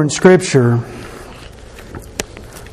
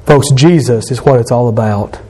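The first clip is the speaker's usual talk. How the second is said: ordinary talk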